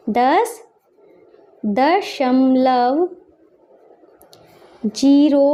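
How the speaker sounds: very stretched words with long gaps; slow dictation. Shown as a demonstration. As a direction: spell it out word by word. Dictating while writing in words.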